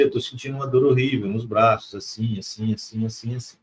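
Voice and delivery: ordinary delivery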